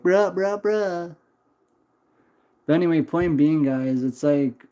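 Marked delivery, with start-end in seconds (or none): 0.0-1.2 higher mock bruh from a bratty kid. Streamer mocks a chatting child, higher pitched. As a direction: parody the child spamming bruh. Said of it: "this child to be like".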